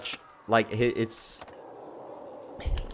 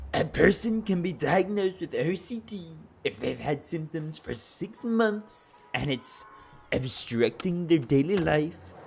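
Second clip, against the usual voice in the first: high, drawn-out silly reading voice; put-on comic voice, pitched well up; funny voice; 7 semitones higher, slower than usual. Shown as a demonstration. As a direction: read the study notes in a goofy, high-pitched character voice. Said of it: "just talk in a funny voice".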